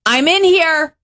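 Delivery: high-pitched call through the door. Acted. Occupant answering a bathroom knock, calling out high. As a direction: pipe up to the person knocking. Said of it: "here are the popular responses for how you should respond".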